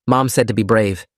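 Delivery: plain reading voice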